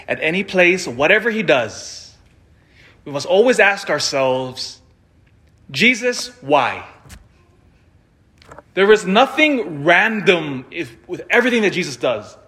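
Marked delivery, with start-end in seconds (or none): none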